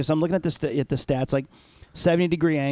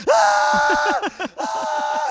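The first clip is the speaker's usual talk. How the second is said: high-pitched mock scream; retold terrified scream. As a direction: scream like you're on a rollercoaster. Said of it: "I'm screaming my head off".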